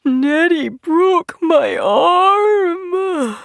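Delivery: high, slow crying wail; very high, drawn-out sob. A narrator's reading. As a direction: wail it through tears. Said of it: "he wailed".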